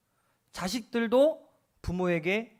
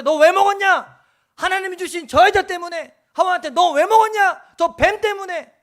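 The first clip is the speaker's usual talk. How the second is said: God's question; 12 semitones higher, 15 dB louder than usual. Acted. God confronting Adam and Eve over the fruit, loud and high. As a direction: call them out from on high.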